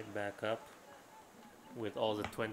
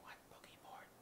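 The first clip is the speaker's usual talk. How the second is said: whisper yelling; 22 dB quieter than usual. Real, not acted. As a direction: whisper it with force. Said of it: "Why are we whispering?".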